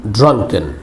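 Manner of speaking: ordinary talk, nothing marked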